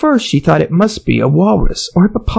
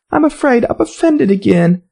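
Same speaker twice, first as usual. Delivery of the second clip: sorrowful; 8 semitones higher than usual. A narrator's reading. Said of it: "in a sorrowful tone".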